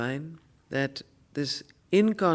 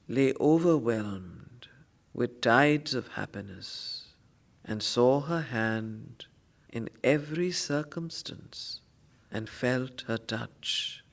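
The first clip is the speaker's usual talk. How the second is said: poem recitation, fading; 2 semitones lower, slower than usual. Acted. Teacher reciting Savitri to students, fading to a hush. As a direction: start bold, then sink to a reverent hush.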